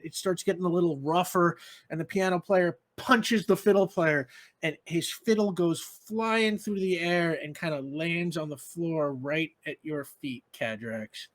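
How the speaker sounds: ordinary talk, nothing marked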